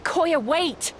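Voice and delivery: high, pleading call; calling after her. Acted. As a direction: shout for her to stop. Calling after departing sister.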